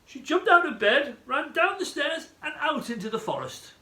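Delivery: high, urgent storytelling; alarmed excitement. A narrator's reading. Narrator telling of Goldilocks fleeing the bears, excited. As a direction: rush of drama, pitched right up.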